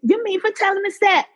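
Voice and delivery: high, fast, laughing mock mother; shocked mother. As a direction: squeak it up like a flustered, panicked mom. Imitating her shocked mother.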